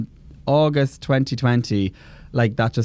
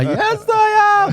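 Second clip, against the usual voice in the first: shrieked high and loud; playful high insistence; 4 dB louder, much slower than usual. Pretend. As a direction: insist it, squealing with mock outrage. Insisting she is his mom.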